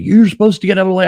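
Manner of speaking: high, loud, fast mock yell; imitating angry yell. Angry driver yelling at him from behind. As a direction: ham up the furious driver's rant. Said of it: "he's like".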